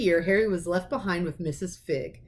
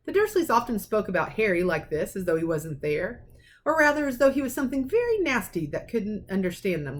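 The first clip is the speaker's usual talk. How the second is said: plain reading voice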